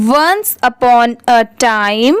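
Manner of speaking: very slow, word-by-word reading; careful model reading. Shown as a demonstration. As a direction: read it out slowly for learners, stretching each word. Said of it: "अच्छे से read करते". (धीरे-धीरे, एक-एक शब्द पढ़ते हुए)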